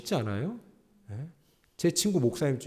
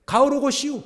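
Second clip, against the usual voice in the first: put-on Chungcheong accent; 13 semitones higher, 9 dB louder than usual. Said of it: "충청도에서 한 사람이 그렇게 얘기하더래요". (충청도 사투리로 높고 크게)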